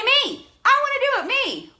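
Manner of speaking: high, loud, eager volunteering cry; eager shout. Pretend. Coach acting out an eager viewer, high loud shout. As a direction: squeal it like a fan begging to be picked.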